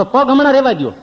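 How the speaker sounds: high, loud wincing plea; high, loud begging through pain; pained pleading. Retold or imitated. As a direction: beg him to skip it, hurting.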